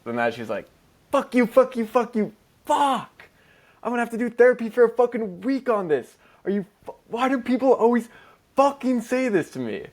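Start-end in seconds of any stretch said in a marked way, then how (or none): 1.1-9.9 much higher, louder, hammed-up angry friend; over-the-top high-pitched mock outburst; playful mock rant. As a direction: squeal out a playful fake tantrum. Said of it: "she was a little more playful".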